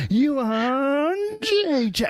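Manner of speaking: "Jacek" stretched out slow and high; mock announcer drawing out "Yun Jacek", high and slow; trying out pronunciation. Announcer rehearsing how to say it, exaggerated trial pronunciation. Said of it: "how am I say it".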